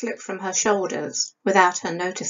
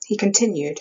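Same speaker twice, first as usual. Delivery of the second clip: usual speaking voice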